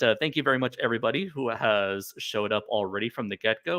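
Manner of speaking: usual speaking voice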